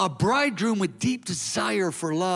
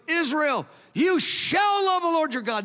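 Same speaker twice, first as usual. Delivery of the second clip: prophetic declaration; 8 semitones higher than usual. Retold or imitated. Preacher voicing Jesus declaring love over Israel, ringing shout. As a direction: call it out over the whole nation. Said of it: "he declares the prophetic declaration".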